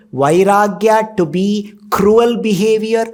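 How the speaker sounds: ordinary talk, nothing marked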